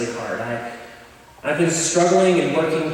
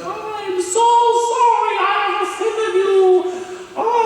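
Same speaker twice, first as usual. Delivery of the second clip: witchy apology. Retold or imitated. Imitating the teacher apologizing to Crimson. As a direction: wail it like a tiny old witch. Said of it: "She's like".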